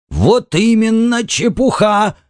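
high, drawn-out angry cry; voice nearly breaking. A narrator's reading. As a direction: cry it out slowly at the top of your pitch. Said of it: "Он едва не сорвал голос". (гневно, почти срывая голос)

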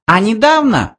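very high little voice; mouse voice. A narrator's reading. Mouse continues his colleague's tale, high voice. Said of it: "продолжал его коллега".

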